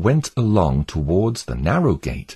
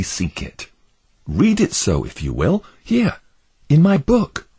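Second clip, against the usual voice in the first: higher, earnest pleading pilgrim voice; 5 semitones higher than usual. Acted. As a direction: beg your neighbours to flee the doomed city.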